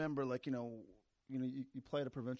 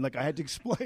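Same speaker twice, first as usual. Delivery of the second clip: normal talking voice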